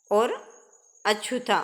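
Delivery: normal talking voice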